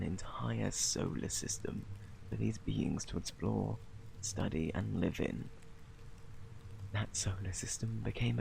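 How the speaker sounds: put-on soft, hushed storytelling to a baby; bedtime story narration. Parent telling a sleeping baby a bedtime story, soft and hushed. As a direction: ease the child to sleep with a quiet tale.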